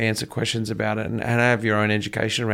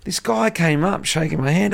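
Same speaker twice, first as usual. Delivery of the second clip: ordinary delivery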